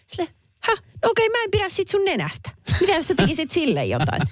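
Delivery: high, mocking voice of a rude critic; mock snooty complainer. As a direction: sneer it out way up high like a petty critic. Mock quote of rude commenter. (korkea pilkkaava ääni ilkeälle arvostelijalle)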